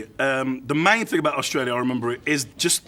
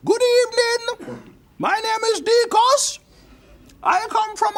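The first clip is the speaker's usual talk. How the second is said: put-on old Jamaican man. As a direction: creak it out as an elderly Jamaican. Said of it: "there's an old Jamaican guy in it".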